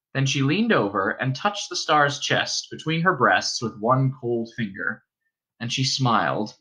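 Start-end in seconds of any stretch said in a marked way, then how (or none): none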